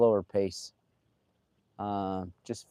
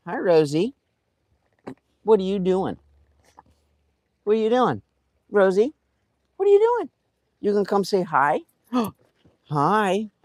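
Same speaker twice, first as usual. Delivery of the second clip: high-pitched, louder sweet talk; cooing greeting; 10 semitones higher, 5 dB louder than usual. Real, not acted. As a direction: coax Rosie over in a high, playful voice. Greeting Rosie in a fond high voice.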